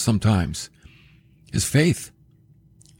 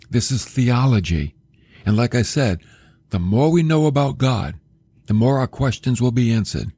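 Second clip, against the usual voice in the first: ordinary talk, nothing marked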